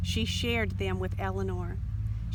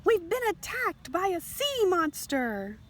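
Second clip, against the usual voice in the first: yelling; 9 semitones higher than usual. Acted. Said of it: "They ran onto the beach yelling".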